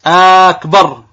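"أكبر" opening on a long held aa: "aaakbar"; stretched hamza of akbar. Shown as a demonstration. Teacher demonstrating a forbidden takbir, first vowel of "أكبر" overlong. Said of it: "إذا مد همزة أكبر".

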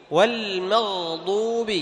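"والمغضوب" very slow with the lam sounded clearly. Shown as a demonstration. Teacher modelling the recitation for students, slow. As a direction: stretch "والمغضوب" and keep the lam distinct. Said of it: "تظهر سكون اللام".

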